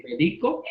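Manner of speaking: "Medico" as me-DI-co, rising at the end; stress on the middle syllable. Shown as a demonstration. Teacher quizzing the class, "Medico" stressed on DI. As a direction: stress DI in "Medico", lift the ending. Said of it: "como es llana".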